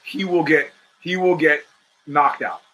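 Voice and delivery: usual speaking voice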